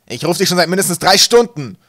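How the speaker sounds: very loud, high exasperated roar; angry bellow. A narrator's reading. Captain scolding his late mate, bellowing. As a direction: roar it as an accusation. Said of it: "brüllte Wolkenwolf".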